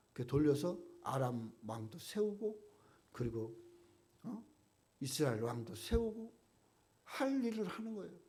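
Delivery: usual speaking voice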